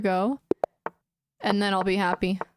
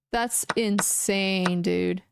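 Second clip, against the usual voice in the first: ordinary talk, nothing marked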